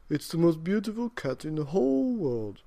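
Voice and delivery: put-on proudly, as the old man; old man boasting of the cat. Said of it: "said the very old man".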